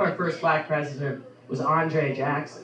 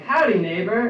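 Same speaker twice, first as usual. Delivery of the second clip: cheery howdy; 5 semitones higher, 5 dB louder than usual. Pretend. Greeting neighbor milf over mail.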